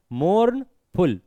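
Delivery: "मोर्न", a clear break, then "फुल"; two separate parts. Shown as a demonstration. Teacher demonstrates two syllables, "मोर्न" and "फुल" said apart. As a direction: say "मोर्न" and "फुल" as separate pieces with a pause between. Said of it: "दो हिस्सों में बोल रहे हैं".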